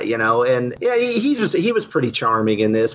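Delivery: ordinary delivery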